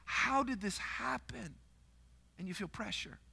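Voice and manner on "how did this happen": slow, hushed question of shock; stunned disbelief. Retold or imitated. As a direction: ask it softly, as if reeling from bad news. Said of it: "you're, like, just freaked out".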